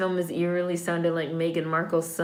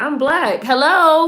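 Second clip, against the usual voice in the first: high and pointed, rising louder on Hello; sassy mock quote; 7 semitones higher, 7 dB louder, slower than usual. Viewer voicing the heroine spelling it out, sassy. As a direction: spell out the obvious with attitude. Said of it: "She's like".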